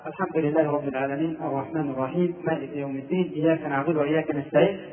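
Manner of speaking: low, soft, unchanted rattle through the verses; hushed prosaic gabble of a silent prayer; like reading a newspaper. Shown as a demonstration. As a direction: recite under your breath with no elongation. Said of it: "كأنه يقرأ جريدة".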